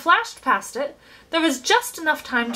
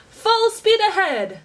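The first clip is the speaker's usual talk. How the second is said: much higher, slow, stretched-out cry; shouting gleefully; 11 semitones higher, 4 dB louder, slower than usual. A narrator's reading. Chocolate maker leaping up with his stick, gleeful shout. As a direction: sing it out like a captain. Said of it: "shouted Mister Wonka".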